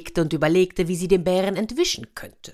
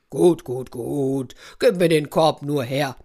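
impatient bear wants the basket; lightly voiced bear grumble; grumbling bear; 3 semitones lower than usual. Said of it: "brummte der Bär".

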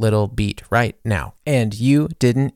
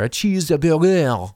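overpronounce everyday food like a gourmet; mock overpronounced; 4 semitones higher, slower than usual. Trend stitchers mocking the blogger, hammed-up. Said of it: "overpronouncing that".

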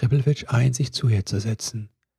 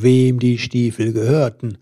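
plain reading voice

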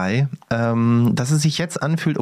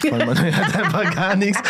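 laughing; 8 semitones higher than usual. Real, not acted. Laughing while telling.

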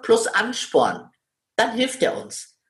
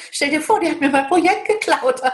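imitating a woman who laughs off her anger, pitched higher; laughing while angry; 7 semitones higher than usual. A woman laughing off her anger while telling a friend. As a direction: giggle through the complaint about a stolen project. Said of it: "immer so lachen dabei".

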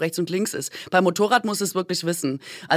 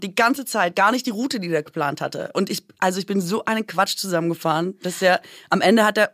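ordinary delivery

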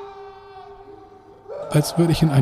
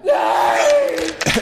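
screaming while falling. Acted. Attacked from behind.

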